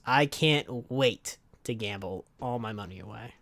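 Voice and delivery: ordinary talk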